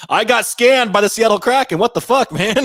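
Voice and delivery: high, loud comic indignation; mock outrage. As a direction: yell it like a cheated customer, half joking. Mock outrage at being scammed.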